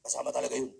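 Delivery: mock barber, deep and matter-of-fact; barber's voice. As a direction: drop low like the barber who kissed him. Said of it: "Ganun yung boses niya".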